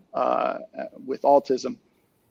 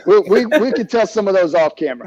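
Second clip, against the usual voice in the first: higher and louder, laughing through it; real laughter bubbling up while deflecting; 6 semitones higher, 8 dB louder than usual. Real, not acted. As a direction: laugh off the joke as you answer. Laughing reply to host's teasing.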